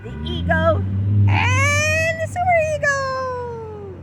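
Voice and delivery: high, loud, stretched-out shout; crazy sing-song. Acted. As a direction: ham it up wildly. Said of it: "my crazy version".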